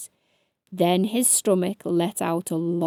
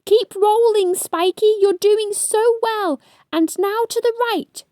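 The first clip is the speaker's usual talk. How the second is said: high, louder excited cheer; cried encouragement; 11 semitones higher, 5 dB louder than usual. A narrator's reading. Ladybird cheering hedgehog on, loud cheer. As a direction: cheer him along, urgent. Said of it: "cried Stripey".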